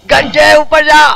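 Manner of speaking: shouting at the character to climb; frustrated shout, pushing him upward; yelling up. Real, not acted. Gamer yelling at his character to go up, loud.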